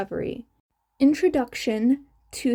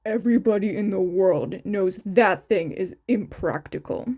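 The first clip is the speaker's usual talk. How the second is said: lower, grumpy stubborn man's voice; stubborn grumbling Shota; 5 semitones lower than usual. Acted. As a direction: grumble it like a sulky patient. Shota's voice in story.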